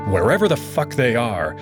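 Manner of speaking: ordinary delivery, nothing marked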